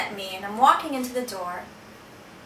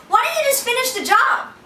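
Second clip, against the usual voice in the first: retold yell, much higher and louder; a defiant cry at the father who is choking him; yelling back; 11 semitones higher, 6 dB louder than usual. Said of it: "and I yell back at him".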